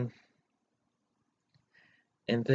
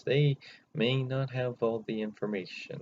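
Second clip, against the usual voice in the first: ordinary delivery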